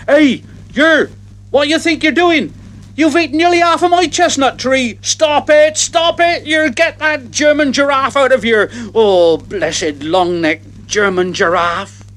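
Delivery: high, shouting head gardener; angry gardener. Retold or imitated. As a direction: yell at the giraffe eating your tree. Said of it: "didn't the head gardener make a noise?".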